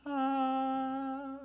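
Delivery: one long "Ah" held high and steady; sustained high "Ah" with no rise or fall; level tone. Shown as a demonstration. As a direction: hold "Ah" on a single high note. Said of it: "a level tone".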